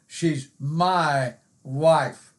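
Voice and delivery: "my" held long, set apart before "wife"; slow down and lean on "my"; stress on my. Retold or imitated. Husband introducing his wife to the church folks, stress on "my". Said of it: "put some emphasis on that personal pronoun".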